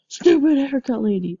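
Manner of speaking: stupid haircut lady thrown out high and loud; angry. Shown as a demonstration. Gamer cursing the haircut lady he hates, angry. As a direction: spit out stupid with contempt. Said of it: "You gotta say it like that".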